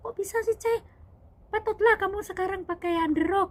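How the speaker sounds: very high, loud boss voice; shocked exclaiming. Retold or imitated. Female boss seeing her maid's injury, shocked exclaiming. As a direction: imitate a boss aghast at the injury.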